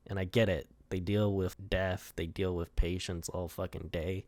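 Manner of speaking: normal talking voice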